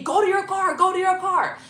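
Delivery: high, loud imitated shouting; retold yelling. Boyfriend ordering her off to her car, yelling imitation. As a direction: yell the order as he did, urgent. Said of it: "He's yelling at me".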